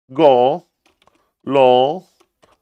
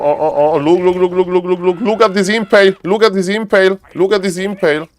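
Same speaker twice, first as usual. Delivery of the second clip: higher, rapid-fire excited repetition; 7 semitones higher, faster than usual. Real, not acted. Player cheering on his impale in the game, excited. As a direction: get carried away, repeating it in a higher voice.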